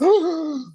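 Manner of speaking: sharp, high gasp of a stunned crowd; imitated shocked gasp. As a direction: gasp like a whole room stopping dead. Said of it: "the whole place, whole joint stops".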